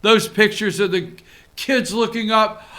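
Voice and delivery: usual speaking voice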